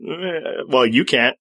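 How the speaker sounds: ordinary delivery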